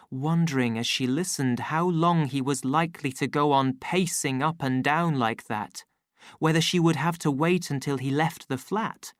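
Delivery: plain reading voice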